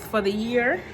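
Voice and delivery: ordinary delivery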